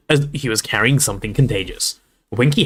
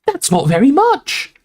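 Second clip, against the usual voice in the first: much higher, slow indignant protest; indignant shout; 4 dB louder, slower than usual. A narrator's reading. Hermione protests the elf's wages, indignant high shout. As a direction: object indignantly, drawing it out. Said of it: "shouted Hermione indignantly".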